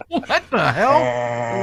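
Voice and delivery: slow laughing disbelief. Real, not acted. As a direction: laugh it out, drawn out and high. Laughing at answer.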